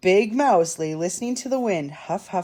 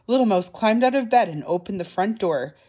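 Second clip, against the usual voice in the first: ordinary delivery, nothing marked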